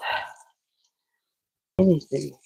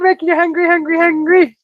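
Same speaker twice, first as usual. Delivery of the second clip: teasing singsong; 10 semitones higher than usual. Real, not acted. Teasing viewer.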